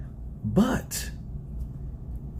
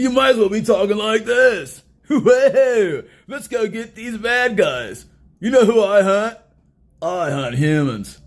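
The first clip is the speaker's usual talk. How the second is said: mock a hunter talking like a goofy turtle; Michelangelo impression; 2 semitones higher, 3 dB louder than usual. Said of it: "talk like freaking Michelangelo".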